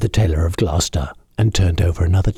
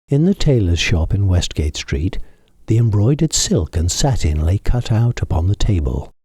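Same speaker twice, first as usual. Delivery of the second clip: ordinary delivery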